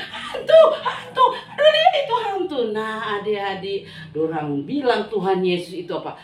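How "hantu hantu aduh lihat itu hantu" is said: very high, loud panicked screaming; half-laughing panicked yell; terrified shriek. Acted. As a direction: scream like you've seen a ghost. Disciples seeing a ghost.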